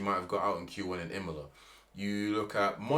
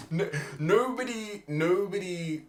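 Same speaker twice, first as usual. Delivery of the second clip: exasperated; 11 semitones higher, 4 dB louder, slower than usual. Real, not acted. Exasperated outburst continues.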